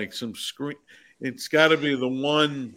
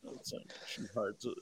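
ordinary talk, nothing marked

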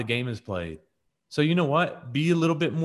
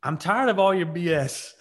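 higher, louder, slower retold yell at Renee; man yelling at partner; 6 semitones higher, 4 dB louder than usual. Boyfriend fed up with Renee, shouting higher and louder. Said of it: "I hear this voice that says".

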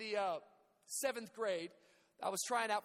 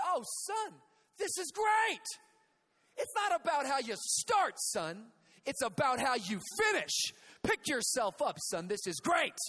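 higher, louder overjoyed dad; dad shouting with delight from a hole; cheerful dad shouting; 5 semitones higher, 5 dB louder than usual. Retold or imitated. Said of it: "He said".

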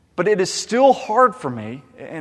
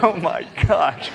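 laughing; 3 dB louder, slower than usual. Retold or imitated. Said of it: "I was like".

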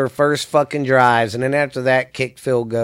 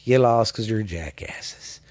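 a softer, breathless whispered insult; whispered jab; 3 semitones lower, 7 dB quieter than usual. Acted. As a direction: whisper it like a sneaky dig. Sneering aside to Cowboys fans.

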